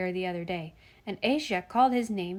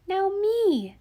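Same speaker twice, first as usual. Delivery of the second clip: put-on high, loud, drawn-out small child's cry; eager little child; 11 semitones higher, 5 dB louder than usual. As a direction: pipe up like an eager toddler. Eager toddler cries out for his turn.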